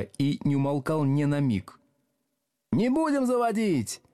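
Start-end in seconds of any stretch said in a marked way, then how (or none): 2.7-4.0 rowdy yelling. A narrator's reading. Back desks refusing the teacher, high yelling. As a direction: holler the refusal as a class. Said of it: "орали с задних парт".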